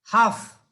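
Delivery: हाफ said as haaf with a long open a, no l sound; English half. Shown as a demonstration. Teacher showing students हाफ as haaf, loud and clear. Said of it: "जैसे अंग्रेजी का एक शब्द है".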